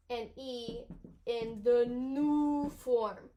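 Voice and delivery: ordinary talk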